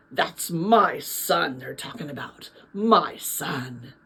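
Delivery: a quiet, awed boast about his son; lightly voiced proud dad, hushed and slow; proud father. Father proudly telling a neighbour.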